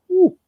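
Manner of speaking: a high whistling whew. Real, not acted. Man recalling Canadians who drank all night, high whew. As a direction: blow out an amazed whew. Marvelling at heavy drinkers.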